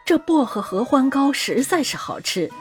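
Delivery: fawning. A narrator's reading. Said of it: "讨好地说".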